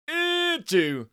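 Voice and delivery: very high, loud, drawn-out wail dropping on the number; over-the-top high announcer cry; game show announcer call. Acted. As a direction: belt out a darts game show prize number.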